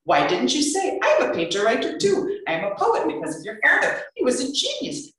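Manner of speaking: put-on high, accented disbelieving delight; thrilled clerk. Clerk learning she's the painter's daughter, thrilled. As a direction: gush like a starstruck fan.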